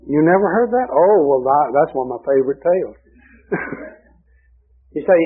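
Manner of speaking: ordinary delivery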